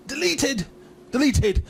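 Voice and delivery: high-pitched theatrical chant; hammed-up high repeat; mock catchphrase cry. Imitating Hardy's catchphrase in promo hype.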